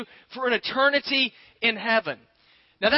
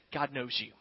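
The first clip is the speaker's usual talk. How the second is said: hushed emphasis; 8 semitones lower, 10 dB quieter than usual. Real, not acted. Preacher assuring his congregation, low and hushed. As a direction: drop low and soft on God knows you. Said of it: "I want you to know today".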